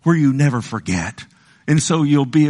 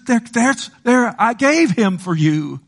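pleading as God; 6 semitones higher than usual. Retold or imitated. God pleading with sinners to be saved, impassioned high plea. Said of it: "He says".

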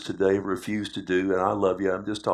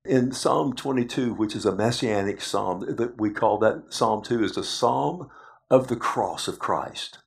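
ordinary talk, nothing marked

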